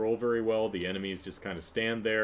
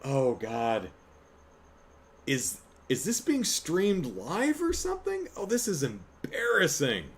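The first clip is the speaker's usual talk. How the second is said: embarrassed disbelief; 6 semitones higher than usual. Retold or imitated. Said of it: "I'm like".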